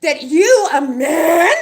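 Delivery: loud, high, half-laughing shout; real outrage breaking into a loud laugh; indignant outburst. Real, not acted. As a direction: spit out the insult with a furious laugh. Furious rant about being insulted.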